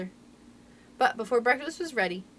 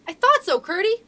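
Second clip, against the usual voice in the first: high, loud, triumphant exclamation; calling out; 9 semitones higher, 9 dB louder than usual. A narrator's reading. Irene seeing the healed leg, calling out to Curdie. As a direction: call it out, pitch and volume leaping. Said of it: "but Irene called out".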